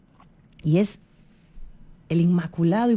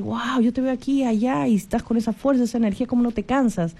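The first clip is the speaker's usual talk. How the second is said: admiring exclamation; 5 semitones higher than usual. Retold or imitated. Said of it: "alguien te puede decir".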